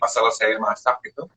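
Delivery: ordinary delivery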